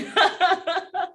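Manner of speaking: high laugh catching in the throat; emotional burst of laughter; tearful laugh. Real, not acted. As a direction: laugh through rising tears. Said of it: "sorry to get emotional".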